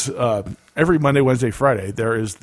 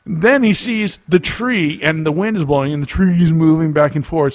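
usual speaking voice

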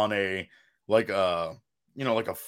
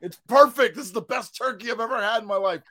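rave shout; 12 semitones higher than usual. Retold or imitated. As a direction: rave like it's the best meal ever. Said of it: "I was just like".

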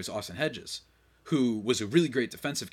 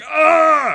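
sudden, much higher and much louder "Ah!" shout; imitated triumphant yell; 19 dB louder than usual. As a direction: belt out a player's victory whoop. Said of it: "I still remember Jake Cronenworth going".

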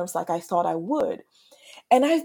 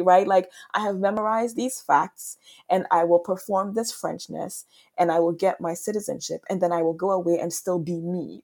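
normal talking voice

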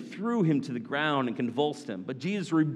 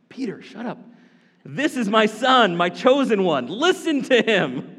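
booming, louder voice of God from the cloud; 6 semitones higher, 8 dB louder than usual. Acted. God the Father booming at Peter from the cloud.

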